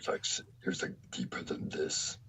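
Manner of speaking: very low, soft growl; very deep voice. Shown as a demonstration. Fan showing how deep the actor's voice goes, very deep voice. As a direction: drop your voice as deep as it goes. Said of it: "his voice can go down to".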